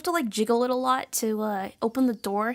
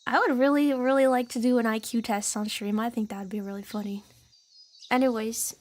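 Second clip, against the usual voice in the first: normal talking voice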